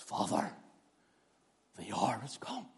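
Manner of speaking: hushed whisper to the Father; an intimate whispered prayer. Shown as a demonstration. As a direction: breathe it, barely voiced. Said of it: "I wonder how he said it".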